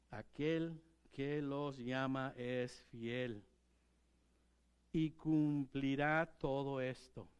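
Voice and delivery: slow, solemn reading; unhurried scripture quote. Real, not acted. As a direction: let each word land slowly.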